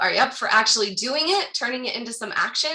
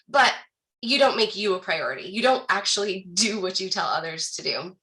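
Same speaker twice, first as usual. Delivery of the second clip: usual speaking voice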